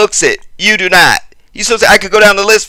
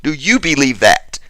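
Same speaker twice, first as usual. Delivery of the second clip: "you" stressed, the rest falling away lower; 3 semitones lower, 5 dB quieter than usual. Real, not acted. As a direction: put the weight on "you", then drop off. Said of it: "Let's forget your father".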